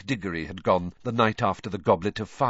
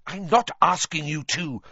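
raised, exasperated retort; acted impatience, pitched up; impatient; 6 semitones higher, 3 dB louder than usual. A narrator's reading. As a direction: brush off the objection impatiently. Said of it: "Hermione said impatiently".

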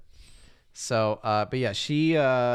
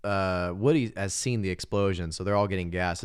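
normal talking voice

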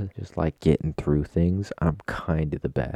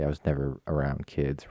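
ordinary delivery

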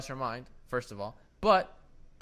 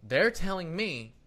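retold exasperation; 4 semitones higher, 7 dB louder than usual. Retold quarrel with clerk.

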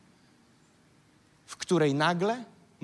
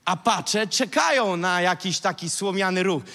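usual speaking voice

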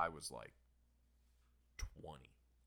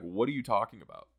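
imitating a baffled mother, higher and louder; retold demand for an explanation, voice raised; incredulous mom; 5 semitones higher, 9 dB louder than usual. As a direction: act out mom's loud disbelief.

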